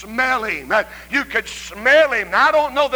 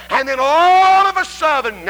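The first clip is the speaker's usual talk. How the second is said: louder, higher surge on ALL; swelling fervour; 5 semitones higher, 5 dB louder than usual. Real, not acted. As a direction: surge suddenly into a raised preaching cry. Preaching builds to sudden climax.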